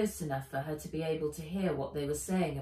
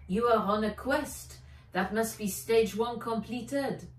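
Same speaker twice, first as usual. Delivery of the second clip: put-on French accent; 4 semitones higher, 4 dB louder than usual. French scientist explains.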